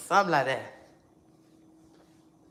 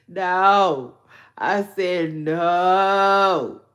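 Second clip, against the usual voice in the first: loud, slow, firm refusal; emphatic real denial; loud insistent no; 13 dB louder, much slower than usual. Real, not acted. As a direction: stretch out the second no. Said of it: "I'm mad as hell".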